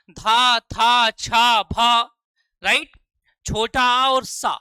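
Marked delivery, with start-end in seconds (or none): 0.1-2.0 धा, था, छा, भा each drawn out slowly and loudly; teacher spells out धा, था, छा, भा for children, slow and loud; letters said slowly. Shown as a demonstration. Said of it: "देखते जाइए आप".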